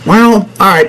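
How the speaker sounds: ordinary talk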